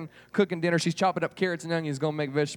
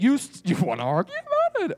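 high-pitched mom challenge; hammed-up mother daring a child to argue; scolding mom; 9 semitones higher, 4 dB louder than usual. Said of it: "sounds like some moms in here".

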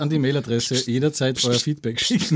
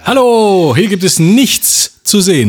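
loud, slow, higher host greeting; game show host; 6 semitones higher, 10 dB louder, slower than usual. Pretend. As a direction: boom it out to the audience.

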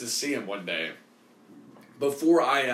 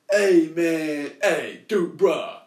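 a lightly voiced casual bro brushing him off, louder; hint of a slick slacker bro; imitating the dodging guy; 3 semitones higher, 6 dB louder, much slower than usual. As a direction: toss it off like a guy ducking the question. Mimicking the guy dodging payment.

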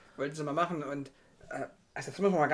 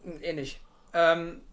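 ordinary delivery

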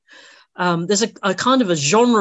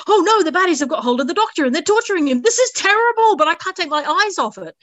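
thrilled horrified viewer; 10 semitones higher than usual. Retold or imitated. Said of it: "It's like".

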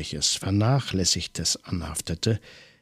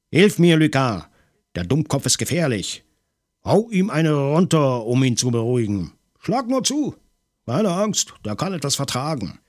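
urgent shouting; 6 semitones higher than usual. A narrator's reading. Maigret, grappling the man, shouts orders at Lucas. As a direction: bark the commands while wrestling.

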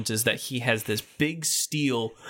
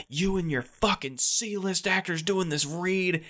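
higher-pitched slow mock yell at the comedians; imitating an angry rights holder ranting; retold yelling; 7 semitones higher, slower than usual. Rights holder yelling at Patton behind the theater, mock angry. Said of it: "yelling at him out back at the theater".

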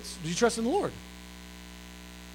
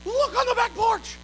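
mock scream much higher; hint of a shrieking wife, much higher; 14 semitones higher than usual. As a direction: shriek for your husband from the other room. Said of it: "She goes".

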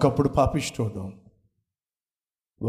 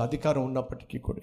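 ordinary talk

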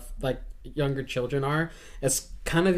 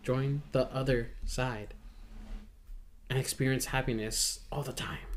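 cheerful ad with eerie menace; 9 dB quieter than usual. Pretend. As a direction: lure them in with a hushed promise. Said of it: "could almost sound like one of those drug commercials".

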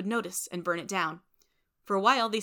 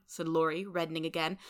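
plain reading voice

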